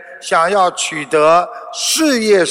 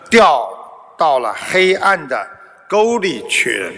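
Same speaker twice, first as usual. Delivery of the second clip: ordinary talk, nothing marked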